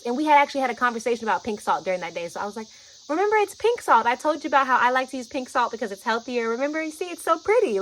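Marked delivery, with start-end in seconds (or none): 3.1-7.8 high, loud, eager reassurance; upbeat coaxing. Retold or imitated. Showing a scared friend the pink salt, eager high coaxing. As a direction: talk it up like a lovely treat.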